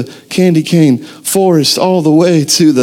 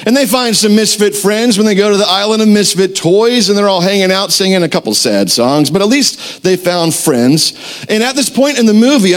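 ordinary talk, nothing marked

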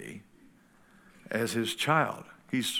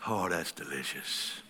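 imagined God savoring; 7 semitones lower, 8 dB quieter than usual. Retold or imitated.

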